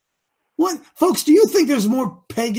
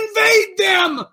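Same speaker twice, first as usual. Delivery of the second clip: emphatic shout; 8 semitones higher, slower than usual. Real, not acted. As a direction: hit it as a rallying cry. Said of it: "I say".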